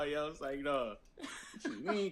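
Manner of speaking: ordinary talk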